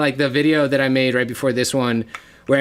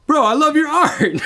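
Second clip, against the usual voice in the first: high, loud starstruck gush; excited fan gushing; 4 dB louder than usual. Retold or imitated. Driver gushing over the art.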